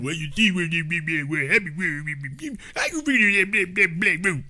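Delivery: soft, garbled stumbling gibberish; mock a tongue-tied dude bumbling along; bumbling mumbler. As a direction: mumble and fumble through a muddled explanation. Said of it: "stumbling and bumbling like handyman".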